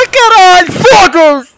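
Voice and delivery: yelled curses, high and loud; loud swearing. Real, not acted. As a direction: shout the curses out. Swearing at game moment.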